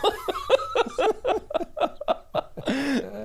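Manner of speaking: long, high-pitched laughing fit; helpless laughter. Real, not acted. Cracking up at co-host's rude wrong answer, long high laugh. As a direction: burst out laughing and keep going. Cracking up at camel toe joke.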